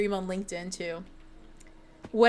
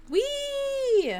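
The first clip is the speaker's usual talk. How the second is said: high, held "whee"; long high-pitched playful whee. Pretend. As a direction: squeal it way up high and hold it. Playful squeal after gambling joke.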